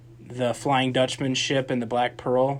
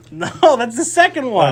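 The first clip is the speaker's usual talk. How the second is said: exasperated; 11 semitones higher, 8 dB louder than usual. Real, not acted. Exasperated correction.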